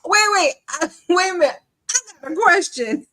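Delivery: high, loud mock interrupting voice; high-pitched, hammed-up impersonation; Nesto. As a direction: butt in loudly with an exaggerated mimicked question. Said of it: "Nesto said".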